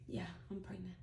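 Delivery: whispered confession, soft and unvoiced. Finally telling mom she's pregnant, whispered. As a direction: barely breathe the words out. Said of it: "And I was like".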